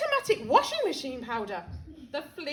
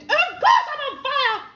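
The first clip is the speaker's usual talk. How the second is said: much higher, louder yell; 10 semitones higher, 10 dB louder than usual. Acted. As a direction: bellow it. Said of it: "she yelled".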